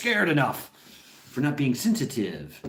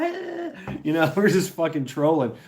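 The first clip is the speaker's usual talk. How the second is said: ordinary talk, nothing marked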